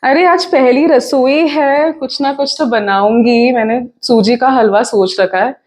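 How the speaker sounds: loud, quick, lively stage voice; chatty daughter-in-law. Acted. Daughter-in-law telling mother-in-law she's off to the market, chatty. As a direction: project it briskly, as if calling to the household.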